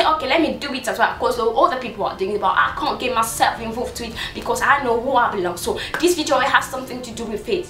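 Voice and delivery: ordinary delivery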